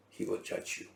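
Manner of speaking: hushed and breathless; dramatic whisper. Acted. Preacher warning his listeners of judgment, whispered. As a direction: let the verdict drop to a whisper. Final verdict of the book.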